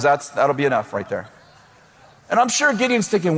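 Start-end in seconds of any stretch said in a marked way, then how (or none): 0.0-1.3 a much lower, calm God voice. Retold or imitated. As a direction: settle it like God deciding. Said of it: "And God goes".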